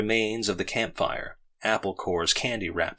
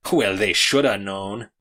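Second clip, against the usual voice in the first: slow, louder lecturing tone; didactically; 5 dB louder, slower than usual. A narrator's reading. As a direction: lay it down like a teacher. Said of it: "said Noonan didactically".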